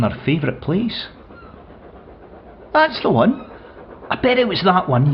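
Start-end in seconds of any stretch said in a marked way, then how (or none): 2.7-5.1 high, bright call of excitement at a passing train; delighted shout. Retold or imitated. Young wife calling out at the trains with delight. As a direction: squeal it out like a thrilled youngster. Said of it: "you would shout out with delight".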